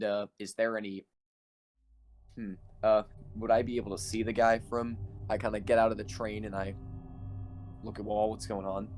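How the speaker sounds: ordinary talk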